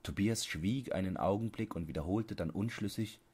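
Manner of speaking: plain reading voice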